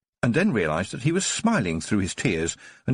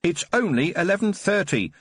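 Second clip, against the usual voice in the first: shouted; 7 semitones higher than usual. Acted. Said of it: "Tiffany shouted after her".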